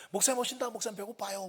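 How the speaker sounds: high, excited youngsters; high-pitched pleading kid call. Retold or imitated. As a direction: imitate teens clamoring for food. Kids calling to the pastor.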